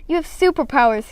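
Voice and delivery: bright excited exclaim; boy thrilled by his friend's secret. A narrator's reading. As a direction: burst out with delight. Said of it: "he exclaimed".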